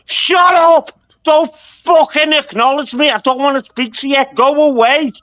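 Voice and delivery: furious shout. Real, not acted. A fed-up caller screaming at his heckler, furious shout. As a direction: blow up and yell him down.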